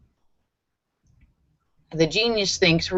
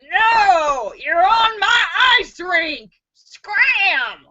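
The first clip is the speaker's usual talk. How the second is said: angry rink owner; 10 semitones higher, much slower than usual. Acted. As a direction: shoo him off the ice. Rink owner retelling punchline.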